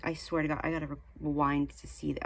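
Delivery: ordinary talk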